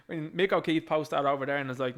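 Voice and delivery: ordinary talk, nothing marked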